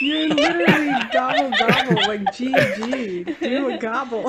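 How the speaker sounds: laughing high and loud; cracking up while teasing. Real, not acted. Teasing friend to gobble.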